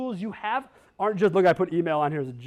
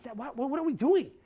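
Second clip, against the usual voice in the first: whisper; 6 semitones higher than usual. Retold or imitated. Venting at the old paperwork.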